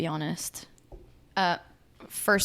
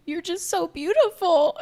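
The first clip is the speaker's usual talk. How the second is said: mock tearful gush; 10 semitones higher, 4 dB louder than usual. Friend's tearful compliment.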